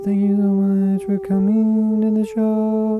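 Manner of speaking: high sing-song falsetto thanks. Pretend. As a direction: flip up into a high voice. Host repeats the welcome in falsetto.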